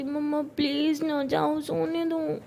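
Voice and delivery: slow, drawn-out plea to be left asleep; sleepy pleading whine. Acted. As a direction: beg mom sleepily, stretching the words. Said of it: "तो रोज़ कहती".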